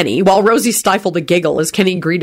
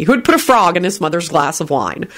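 plain reading voice

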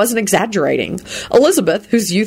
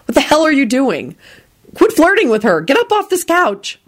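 high-pitched outraged orders; indignant scolding; 9 semitones higher than usual. A narrator's reading. Someone ordering the flirt off the couch, indignantly. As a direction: scold urgently, rising higher. Jealous interruption, ordering him away.

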